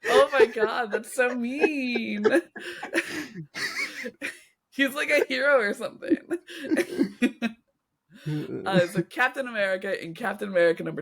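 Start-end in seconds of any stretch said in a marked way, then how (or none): 0.1-2.4 real laughter through the words, higher; can barely get the words out for laughing. Real, not acted. Laughing at the joke. 4.7-7.5 laughing. Real, not acted. Still laughing.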